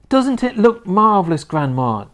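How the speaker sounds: high, loud, eager call; excited boy's shout. A narrator's reading. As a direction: call up to Grandma in delight. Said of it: "George shouted".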